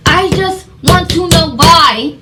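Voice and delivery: loud, high, drawn-out exasperated outburst; frustrated screaming. Retold or imitated. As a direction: re-enact a furious protest, building to a yell. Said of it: "I'm screaming. I'm, I'm frustrated".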